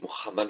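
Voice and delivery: "Muhammad" with a strongly spirantised Arabic H; "Muhammad" with the H pronounced, not silent; aspirated H. Real, not acted. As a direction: roughen the H in "Muhammad" into a throaty fricative. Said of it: "je prononce les H, je l'espérantis".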